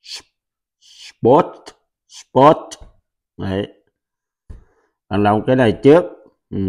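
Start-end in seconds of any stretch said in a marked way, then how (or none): 0.8-1.5 "Spot." với âm t cuối bật rõ; nói "Spot." bật rõ âm t cuối, giọng hơi cao; clear final t. Shown as a demonstration. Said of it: "Phải có chữ thờ ngoài sau". 2.1-2.6 "Spot." với âm t cuối bật rõ; nói "Spot." bật rõ âm t cuối, giọng hơi cao; clear final t. Shown as a demonstration. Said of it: "Phải có chữ thờ ngoài sau".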